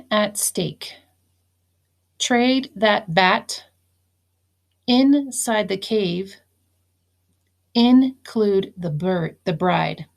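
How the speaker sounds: slow, clearly spaced dictation. Shown as a demonstration. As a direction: read each phrase slowly for the class. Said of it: "Please write down the following phrases".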